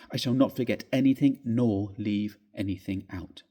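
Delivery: ordinary delivery, nothing marked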